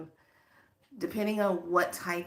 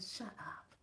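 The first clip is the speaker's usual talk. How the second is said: stunned whispered shut up; 17 dB quieter than usual. Retold or imitated. Healer whispering amazement at client's news. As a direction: breathe it out in disbelief. Teller reacts in disbelief.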